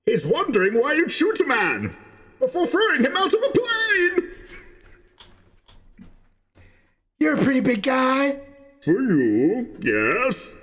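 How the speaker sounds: Bane impression. Voicing a film character.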